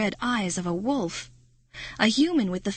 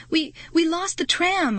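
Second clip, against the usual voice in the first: higher, stammering plea; nervous explaining; 9 semitones higher than usual. Acted. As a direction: explain nervously to Wolf Girl. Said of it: "I explained".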